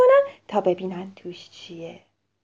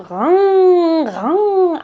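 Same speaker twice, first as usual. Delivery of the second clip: loud imitated motor hum; playful engine noise; car engine vroom; 7 semitones higher, 7 dB louder, much slower than usual. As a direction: make the toy car roar.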